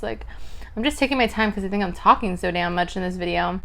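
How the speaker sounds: ordinary talk, nothing marked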